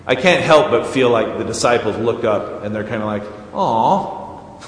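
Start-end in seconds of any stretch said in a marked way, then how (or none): 3.5-4.6 disappointed. Retold or imitated. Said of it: "a little disappointed".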